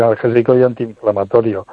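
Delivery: usual speaking voice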